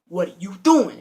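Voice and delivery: loud mock confrontation; mock demand. Burglar confronting a kid on the phone, mock demand. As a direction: call it out sharply, playing it up.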